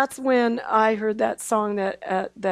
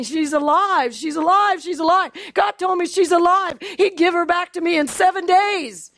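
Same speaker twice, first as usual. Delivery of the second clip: insistent pleading; 8 semitones higher, 4 dB louder than usual. Retold or imitated. Pleading with husband not to go.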